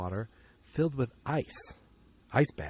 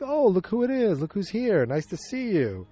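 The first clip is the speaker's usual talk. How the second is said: high-pitched sarcastic welcome, easing down; mock angry greeting; 8 semitones higher, 3 dB louder than usual. Hopper to Joyce as she shows up, mad and sarcastic. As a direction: greet with exaggerated, irritated surprise. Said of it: "he's mad at her".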